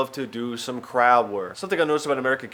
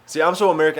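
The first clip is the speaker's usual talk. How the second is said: ordinary talk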